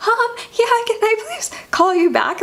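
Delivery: very high, imitated weepy voice; hammed-up upset phone voice; mock holding back tears. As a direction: politely fake composure while sounding tearful. Hiding her crying on business call.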